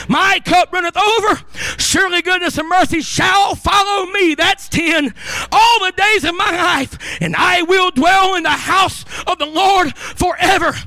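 high, shouted Pentecostal preaching. Real, not acted. Preacher proclaiming the psalm to the congregation, full-force shout. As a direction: drive each phrase hard, building to a long held cry.

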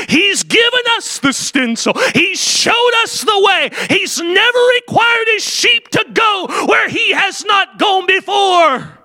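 high, slow, shouted pulpit cry; preacher at full cry; full force preaching. Acted. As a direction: build it to a shout for the flock. Preaching rises again.

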